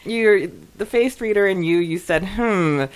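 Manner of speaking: ordinary talk, nothing marked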